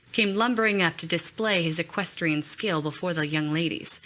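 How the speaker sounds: ordinary delivery, nothing marked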